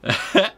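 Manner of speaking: petit rire plus aigu; rire amusé. Real, not acted. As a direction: glousse avant de lire. Rit en lisant la carte. (petit rire amusé)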